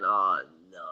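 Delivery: low, soft sinking "oh no"; quietly deflated, seeing his chances vanish; dismayed groan. Retold or imitated. Player thinking to himself after rival scores, dismayed. Dreading the new signing's goal.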